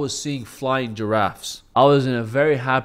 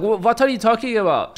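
put-on higher, insistent Iranian-accented dad; 10 semitones higher, 4 dB louder than usual. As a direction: demand it like an annoyed Iranian father. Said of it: "he got that Iranian full accent".

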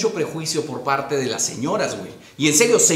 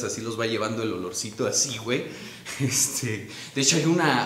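ordinary talk, nothing marked

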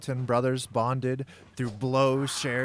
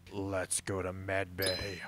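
vampiric; 4 semitones lower, 5 dB quieter, slower than usual. A narrator's reading. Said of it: "with a vampiric gleam".

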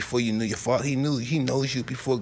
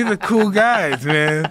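amused quote; 6 semitones higher, 7 dB louder, slower than usual. Retold or imitated. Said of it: "I say".

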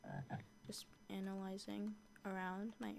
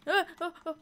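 short, high cries of alarm; startled yelps; 10 semitones higher, 14 dB louder, faster than usual. Real, not acted. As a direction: yelp as if suddenly hit. Hit by arrows in game.

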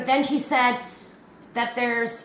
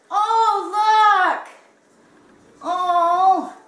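high, loud cries of delight; delighted squeal; 7 semitones higher, 7 dB louder, much slower than usual. Real, not acted. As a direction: squeal at an adorable surprise. First sight of the gift.

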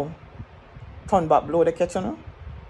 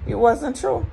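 normal talking voice

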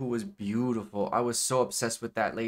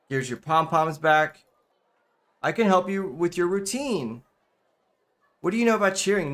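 louder, higher character read; voiced game dialogue; 6 semitones higher, 6 dB louder than usual. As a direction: read the characters' lines in a raised voice.

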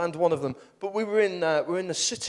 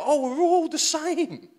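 relieved realisation; 12 semitones higher, 4 dB louder, slower than usual. Retold or imitated. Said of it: "'Cause then you go".

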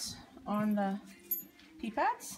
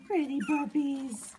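high, louder sing-song pet talk; 8 semitones higher, 4 dB louder than usual. Real, not acted. Greeting the puppies and their papa in baby talk.

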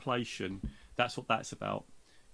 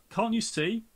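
snapped high and a bit louder; impatient reply; 9 semitones higher, 4 dB louder, slower than usual. A narrator's reading. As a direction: bite back, annoyed at the question. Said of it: "comes the impatient reply".

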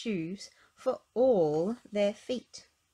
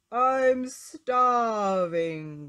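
loud, very drawn-out lion growl; 3 semitones higher, 8 dB louder, much slower than usual. A narrator's reading. Hungry lion facing Little Miss Brainy, growling loud and slow. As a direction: growl it out hugely, stretching each word. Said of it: "growled the lion".

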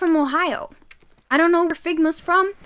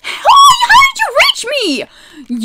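high, loud shocked shriek; startled shriek; 9 dB louder than usual. Real, not acted. Streamer to the mob that reached her, shrieking in shock. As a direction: shriek it as you get hit.

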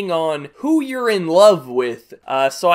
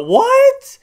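high, loud shocked "what"; incredulous high-pitched shout; baffled outburst; 9 dB louder than usual. Real, not acted. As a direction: yelp it in total disbelief. Baffled reaction to the definition.

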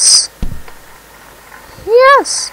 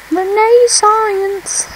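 drawn out; 9 semitones higher, much slower than usual. Acted. Drawn-out sing-song word.

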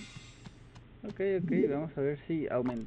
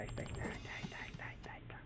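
fading to a whisper; 16 dB quieter, much faster than usual. Real, not acted. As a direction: voice sinking into a tense whisper. Tense gameplay, fading to whisper.

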